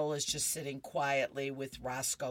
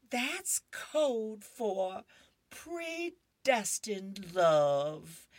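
put-on dreamy little girl; 5 semitones higher, much slower than usual. Little girl's dreamy reply.

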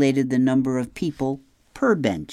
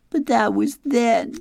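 sobbed; 12 semitones higher, 4 dB louder than usual. Acted. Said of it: "she sobbed".

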